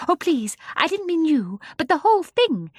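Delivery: put-on a little girl hurrying to apologize; anxious girl. Jane apologizes to the old gentleman, high and pleading.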